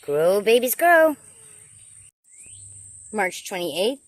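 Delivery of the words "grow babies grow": loud, rising cheer that jumps high on the last word; playful cheering on. Pretend. Gardener to new passion fruit plants, playful cheering on. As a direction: urge them on, building louder and higher.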